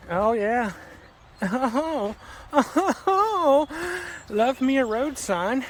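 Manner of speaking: high, loud, slow gush over the sign; thrilled squeal at the road sign; delighted. Real, not acted.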